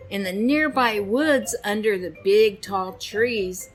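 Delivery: plain reading voice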